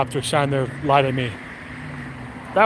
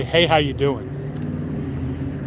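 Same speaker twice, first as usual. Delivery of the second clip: slow mock greeting; 2 semitones lower, 4 dB quieter, much slower than usual. Imagined sniper greeting.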